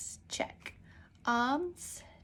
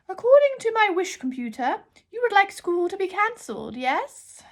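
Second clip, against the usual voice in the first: put-on much higher, louder old librarian voice; a grand, half-deaf librarian calling out; 10 semitones higher, 8 dB louder than usual. Deaf librarian shouts while holding her ear trumpet.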